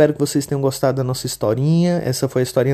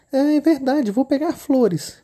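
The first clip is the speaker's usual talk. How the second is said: put-on much higher, childlike little girl voice; 12 semitones higher, slower than usual. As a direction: play the innocent little girl. Menina concorda. (menininha de voz fininha e aguda)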